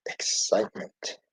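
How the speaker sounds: breathy hushed whisper. A narrator's reading. Teacher hinting at adventure inside Ralphie, whispered. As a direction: whisper it like a secret.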